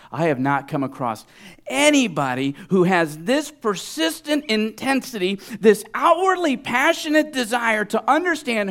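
high, loud, impassioned preaching; preacher's exclamation at full force; astonished shouting. Acted. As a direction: build into an amazed, full-voiced cry. Preacher voices Jesus amazed.

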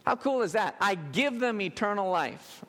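usual speaking voice

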